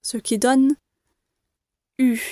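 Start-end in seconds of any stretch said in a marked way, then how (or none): 2.0-2.2 U with a falling tune; falling demo U. Shown as a demonstration. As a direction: let the U drop. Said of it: "L'intonation ici est descendante".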